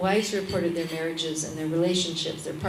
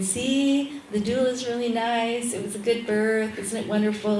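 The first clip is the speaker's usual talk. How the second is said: higher, gentle sweet voice; sweet angel; 4 semitones higher than usual. Retold or imitated. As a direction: coo like a cherub. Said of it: "the little angel's going".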